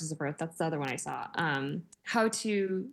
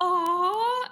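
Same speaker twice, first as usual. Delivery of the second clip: sympathetic aw; 8 semitones higher, 5 dB louder than usual. Retold or imitated. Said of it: "he's like".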